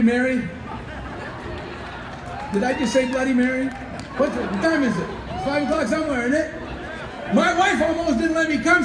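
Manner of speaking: ordinary delivery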